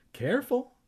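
high, singsong caution; teasing high-pitched warning; soft warning. A narrator's reading. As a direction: warn lightly in a high pitch. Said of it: "Kermit warned softly with his warning".

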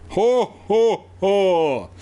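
bursting out laughing. A narrator's reading. Evan can't help himself, performed ho-ho laugh. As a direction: spell out a fake laugh, spaced out. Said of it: "He burst out laughing".

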